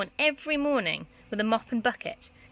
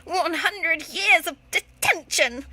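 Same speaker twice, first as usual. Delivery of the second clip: slow, loud, higher bellow; 8 semitones higher, 6 dB louder, slower than usual. Acted. Miss Prigg bellowing at Petula. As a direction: roar the sentence. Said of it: "bellowed Miss Prigg".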